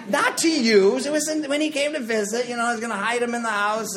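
high-pitched, hurried justification; mock defensive protest. Speaker excusing hidden tequila to the audience, mock defensive protest. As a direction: jump in high with a comic denial. Comic hasty denial to audience.

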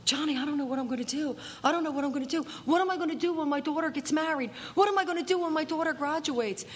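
high, fast fretting voice; panicky worry. Retold or imitated. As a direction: spiral into worry quickly. Worried sponsee voiced.

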